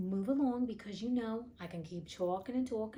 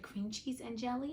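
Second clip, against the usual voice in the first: ordinary delivery, nothing marked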